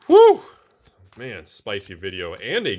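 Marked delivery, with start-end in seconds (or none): 0.1-0.4 high, loud cheer of joy; a real yell of delight; excited whoop. Real, not acted. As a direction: let out a big whoop at the pull. Big hit pulled from box.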